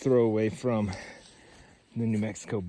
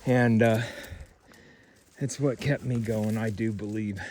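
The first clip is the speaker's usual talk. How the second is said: ordinary talk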